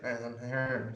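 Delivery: imitated sheepish mumble; mumbling. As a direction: trail off like you were just caught out. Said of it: "Starts, like, mumbling".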